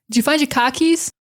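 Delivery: Boston accent, "car" said as "cah". Shown as a demonstration. Teacher showcasing the Boston accent. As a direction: drop the r in "car". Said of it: "showcase the Boston accent".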